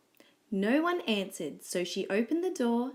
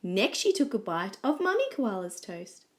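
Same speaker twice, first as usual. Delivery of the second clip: plain reading voice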